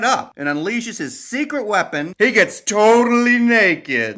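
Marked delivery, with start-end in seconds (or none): 2.2-4.2 slow, loud, high announcement; hammed-up big reveal; mock dramatic reveal. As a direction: shout it like a scandalous secret.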